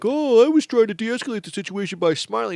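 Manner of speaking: high-pitched whiny excuse, mocking; mocked douchebag. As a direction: imitate him in a put-on high voice. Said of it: "he's like".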